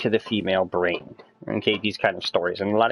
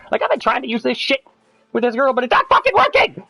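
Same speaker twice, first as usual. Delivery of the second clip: high, frustrated yelling, building louder; exasperated outburst; 12 semitones higher, 5 dB louder than usual. Real, not acted. Venting to friends that it fails with this girl, exasperated. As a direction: let the frustration burst out at a high pitch. Venting that his tricks never work.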